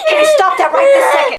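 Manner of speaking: loud, fast, high-pitched reprimand; sharp scolding shout. Acted. Mother scolding Katie to stop right now, loud fast shout. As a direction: bark it out to stop her instantly. Parent scolds misbehaving child.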